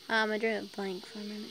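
ordinary delivery

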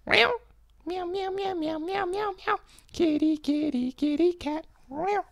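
squeaky high-pitched meowing; high cat meows. Pretend. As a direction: meow like a tiny kitten.

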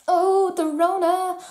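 high, loud, drawn-out mock wail; mock lament. Vlogger joking about pandemic delays, mock lament. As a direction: wail it slowly as a joke.

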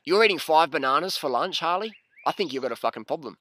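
loud, teasing coworkers; coworkers mocking. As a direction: call him out loudly in front of everyone. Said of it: "everyone around me is going".

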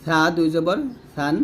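lisped, light th in সা and সান; thin thā. Shown as a demonstration. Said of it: "পাতলা করে বলবেন". (সা জিহ্বার আগা উপরের দাঁতে লাগিয়ে পাতলা)

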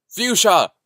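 high, loud shout of a name; urgent, worried yell; alarmed calling out. A narrator's reading. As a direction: call out for someone who has vanished. Calling for the missing companion.

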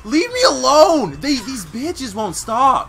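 loud, high, drawn-out yell; slow, high shouted plea. Real, not acted. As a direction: yell in exasperation at attackers. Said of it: "sorry for yelling so much".

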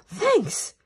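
high, loud, delighted cry; gasp, then cry out thanks. A narrator's reading. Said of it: "cried Bruno".

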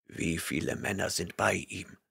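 stern barbarian rebuking a liar; gruff barbarian. A narrator's reading. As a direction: rumble your disgust. Conan's voice.

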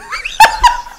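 loud high laughter. Real, not acted. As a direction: crack up laughing. Laughing at friend.

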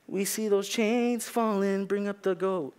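lightly lampoon a worship leader leading song; a hint of a swaying worship leader drawing out the words; mock worship leader. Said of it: "our worship team going, like".

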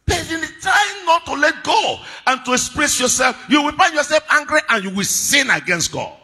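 high, loud sermon delivery; full-force preaching. Acted. Preacher warning that anger leads to sin, full-force preaching. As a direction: press the warning on the congregation.

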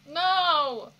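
loud high cry of no; shouted no. Real, not acted. Begging the show not to, a loud shout. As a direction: yell it in dread. Dreading the scene.

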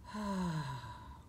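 soft drawn-out sigh; a long contented sigh; demo sigh. Shown as a demonstration. Teacher showing children how to sigh, soft sigh. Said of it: "we smile and we sigh".

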